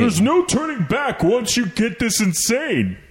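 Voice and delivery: wrestling promo. Retold or imitated. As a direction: read it like a wrestler cutting a promo. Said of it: "We should do wrestling voices".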